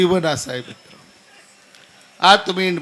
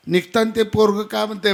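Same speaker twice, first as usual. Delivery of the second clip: normal talking voice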